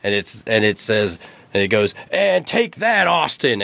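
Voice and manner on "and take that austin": high-pitched wrestler's mock yell. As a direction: throw it out like a hammy fighter landing a punch.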